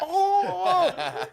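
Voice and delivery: very high gleeful squeal; excited squeal. Retold or imitated. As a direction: squeal with joy like a kid. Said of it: "he's excited".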